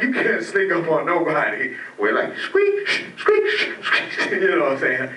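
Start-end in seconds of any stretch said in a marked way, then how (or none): 2.4-4.3 high squeaks and breathy shh, half laughing; squeaky shoe noises. Retold or imitated. Comic sneaking up in squeaky shoes, high squeak and hiss. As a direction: squeak like a sneaker, then hush.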